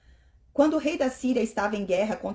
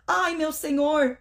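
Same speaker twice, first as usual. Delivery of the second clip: slow, higher alarmed cry; 7 semitones higher, 4 dB louder, slower than usual. Acted. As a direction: call out in dismay.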